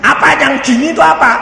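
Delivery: pertanyaan cepat, tinggi, dan lantang; bertanya heboh. Retold or imitated. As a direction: tirukan anak bertanya dengan heboh. Anak bertanya heboh kepada papanya. (seruan tanya bernada tinggi)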